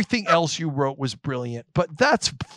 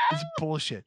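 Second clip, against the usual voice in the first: much softer, resigned aside; quiet deflated repeat; 8 dB quieter than usual. Real, not acted. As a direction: say it under your breath after the outburst. Deflated repeat after the outburst.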